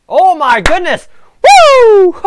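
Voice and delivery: loud, high goal cheer; excited cheer. Real, not acted. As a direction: let it rip, the goal is in.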